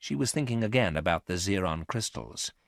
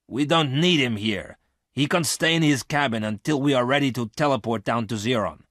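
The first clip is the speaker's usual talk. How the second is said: annoyed objection, louder and higher; angry; 6 semitones higher, 5 dB louder than usual. A narrator's reading. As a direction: object with raised voice, mildly angry. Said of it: "Garth asked Miranda angrily".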